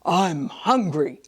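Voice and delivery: slow growly giant. Acted. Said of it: "said the giant".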